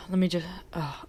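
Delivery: muttered under the breath to herself; barely voiced, talking to herself while searching; whisper. Real, not acted. Host whispering to herself after losing her notes.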